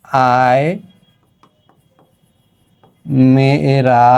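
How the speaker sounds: slow, drawn-out rhyme recital; poem recital. Shown as a demonstration. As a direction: chant the children's rhyme slowly, word by word. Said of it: "ধরো আমি একটা line এখানে লিখি". (ধীরে ধীরে ছড়া আবৃত্তি)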